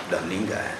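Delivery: put-on low, faint old man's mutter; weak reply. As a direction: answer as if you can't be bothered. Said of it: "Eh, lemahnya".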